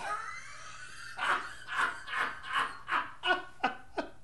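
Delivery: long, high breathy laugh; mocking cackle. Real, not acted. Host amused by own name gag, cackling laugh. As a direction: crack up at your own shout.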